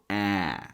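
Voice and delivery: "ah" as the wide short vowel in cat; open cat vowel. Shown as a demonstration. Teacher models the sound for learners, open "ah" as in cat. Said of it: "make sure you get the sound right".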